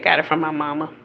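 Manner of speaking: ordinary talk, nothing marked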